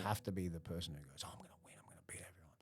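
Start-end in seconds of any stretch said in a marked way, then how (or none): 1.2-2.6 barely audible mimicked pep talk; whispered self-hype. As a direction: imitate someone psyching themselves up in a whisper. Mimics self-hyping person.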